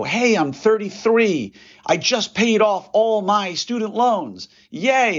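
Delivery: imitating a colleague celebrating paying off loans, hammed-up cheer; mock cheerful brag. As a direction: parody an orthodontist bragging to the room. Mocking a colleague's loan celebration.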